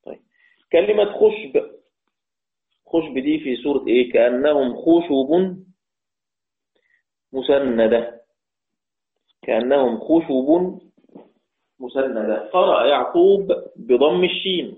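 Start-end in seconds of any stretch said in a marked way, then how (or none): 4.1-8.2 slow measured Quranic recitation of the verse; slow Quran recital. Shown as a demonstration. 9.4-12.5 slow measured Quranic recitation of the verse; slow Quran recital. Shown as a demonstration.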